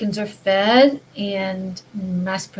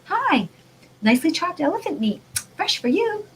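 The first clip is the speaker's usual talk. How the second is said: high, bright sales-pitch voice, rising at the end; a mock advert that sells the meat; cheery package voice; 8 semitones higher than usual. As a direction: play a chirpy talking meat label. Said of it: "a package that says".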